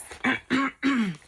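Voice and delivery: emotional throat clear. Real, not acted. Vlogger composing herself before reading, throat clear. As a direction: clear the throat, fighting tears. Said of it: "I'm getting choked up".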